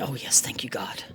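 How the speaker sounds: whispered thanks under her breath; relieved whisper. Real, not acted. Grandma lifting cake out intact, whispering relief.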